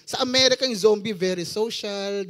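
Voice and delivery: normal talking voice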